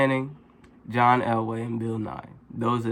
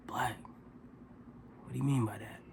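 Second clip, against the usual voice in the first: put-on much softer, half-whispered drunk question; drunk mumble; 15 dB quieter, faster than usual. Drunk stranger puzzling over the answer, soft mumble.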